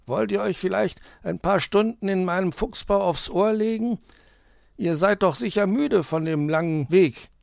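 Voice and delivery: high-pitched inviting fox voice; hospitable fox. Acted. Herr Fuchs invites the tired pair into his den, high friendly voice.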